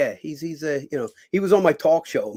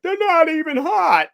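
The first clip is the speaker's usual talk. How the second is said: host voiced; 14 semitones higher, 5 dB louder, slower than usual. Host voice, retold.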